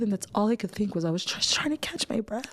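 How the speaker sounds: ordinary delivery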